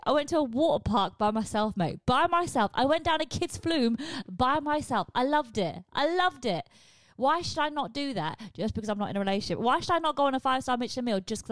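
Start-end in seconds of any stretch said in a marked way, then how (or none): 2.0-2.7 louder, higher burst of pride; proud exclaim, by myself; emphatic exclaim. Real, not acted. Emphatic retelling of water park. 5.9-6.7 higher and louder delighted repeat; bursting with joy; gleeful repeat. Real, not acted. Repeated with more energy.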